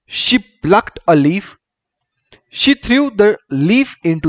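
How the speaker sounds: very slow, deliberate story reading; story read-aloud. A narrator's reading. Teacher reading a bee story to pupils, slow read-aloud. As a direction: read each short sentence slowly, pausing after it.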